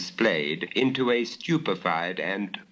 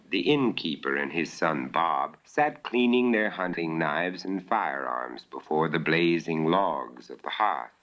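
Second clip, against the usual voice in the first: plain reading voice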